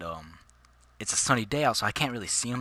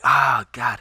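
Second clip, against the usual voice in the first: loud pained Ah God; sudden loud groan of pain; pain cry; 12 dB louder than usual. Retold or imitated. Hurt arm starting to throb.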